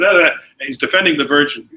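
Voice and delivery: usual speaking voice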